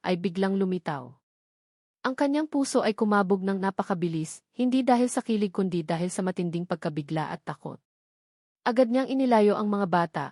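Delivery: usual speaking voice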